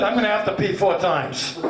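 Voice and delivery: usual speaking voice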